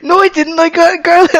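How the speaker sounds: high, insistent imitation of wife; hammed-up denial in a high voice; mock indignant. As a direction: protest it like a wronged child. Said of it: "And you're like".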